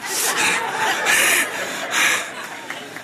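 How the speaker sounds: high breathy laughter; real unvoiced laugh after the punchline. Real, not acted. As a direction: let out an airy laugh. Laughs at own story.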